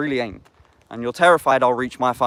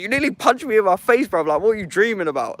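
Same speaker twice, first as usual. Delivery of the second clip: put-on higher, shocked girlfriend scolding him; girlfriend complaining; 8 semitones higher than usual. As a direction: replay her alarmed telling-off, pitched up. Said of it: "She's like".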